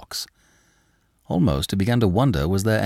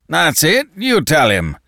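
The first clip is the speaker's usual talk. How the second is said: louder, higher blustering protest; protesting Danton; 7 semitones higher, 4 dB louder than usual. A narrator's reading. Danton protests to Le Chapelier, blustering. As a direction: scoff at him. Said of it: "Danton agreed".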